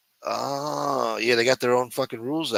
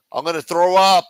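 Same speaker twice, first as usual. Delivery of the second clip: disgusted; 8 semitones higher, 9 dB louder than usual. Real, not acted. Disgusted by sardine juice story.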